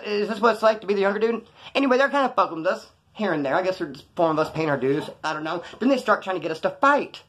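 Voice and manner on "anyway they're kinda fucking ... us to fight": usual speaking voice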